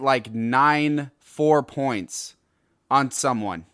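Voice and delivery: ordinary talk, nothing marked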